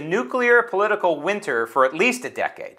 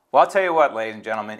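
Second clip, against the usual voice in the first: ordinary delivery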